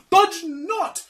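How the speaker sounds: high, loud counter-order; protesting conscience. Acted. Conscience contradicts the fiend, high protesting. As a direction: sing out a high-pitched objection. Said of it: "says the conscience".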